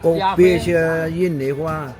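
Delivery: ordinary talk, nothing marked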